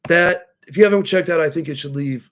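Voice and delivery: ordinary talk, nothing marked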